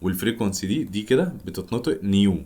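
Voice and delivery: usual speaking voice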